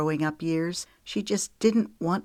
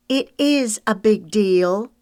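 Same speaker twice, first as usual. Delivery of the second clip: high, loud and slow insistence; countering; 6 semitones higher, 7 dB louder, slower than usual. A narrator's reading. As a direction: push back hard on every word. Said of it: "Meredith countered".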